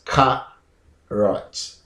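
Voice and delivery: "carrot" with an open "ah", KAH-rot; first pronunciation. Shown as a demonstration. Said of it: "The first pronunciation is".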